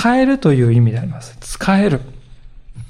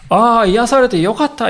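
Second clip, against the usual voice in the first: relieved exclamation; 6 semitones higher than usual. Acted. Healed man glad only for himself, relieved exclamation. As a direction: exclaim it with open, happy relief.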